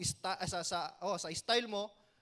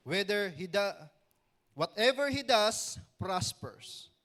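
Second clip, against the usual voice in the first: slow, deliberate reading of the verse; scripture reading; 5 dB louder, much slower than usual. Acted. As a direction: read each phrase slowly, with weight. Reads Bible verse aloud. (mabagal at taimtim na pagbasa ng talata)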